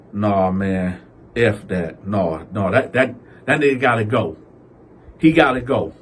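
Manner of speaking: lightly voiced Cal, low and fed up; low fed-up mutter. Cal to himself about Daryl, low and fed up. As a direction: drop the voice, fed up and done.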